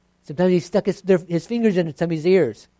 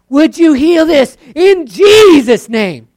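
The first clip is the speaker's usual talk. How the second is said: louder, higher, slow with force; forceful loud prayer; 10 semitones higher, 16 dB louder, slower than usual. Retold or imitated. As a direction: really push it out. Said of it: "you say it louder with more force".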